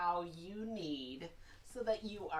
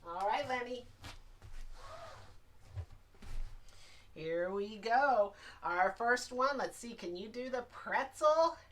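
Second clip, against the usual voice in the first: cheery; 4 semitones higher than usual. Acted. Cheerfully addressing puppet Lenny.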